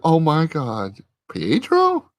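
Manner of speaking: high imitated gasp of disbelief, rising to a question; astonished recognition. Sister finds her recast brother at the door, astonished.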